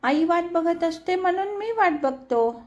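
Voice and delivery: put-on pert little boy voice; quick child answer. A narrator's reading. Boy answers auntie quickly, pert childish voice. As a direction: answer at once like a clever child. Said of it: "तो झटकन उत्तर दे".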